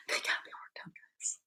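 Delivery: whispered confession to the chat. Real, not acted. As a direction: breathe it out as a sheepish secret. Whispered confession after laugh.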